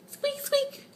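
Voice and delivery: high, soft mouse squeak. Pretend. Mom voicing Jerry the toy mouse with a high squeak.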